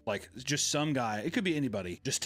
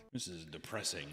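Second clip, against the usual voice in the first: glum mutter; 6 semitones lower, 11 dB quieter than usual. Retold or imitated. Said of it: "and I was like".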